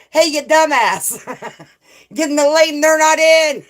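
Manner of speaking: high, loud imitated telling-off; mock shout. Streamer acting out a viewer scolding a driver, mock shout. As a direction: holler it as a laughing imitation. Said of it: "Sunnyside is like".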